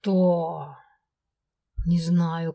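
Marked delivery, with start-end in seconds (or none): 0.0-0.8 growling hiss. A narrator's reading. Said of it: "соединить рык с шипением".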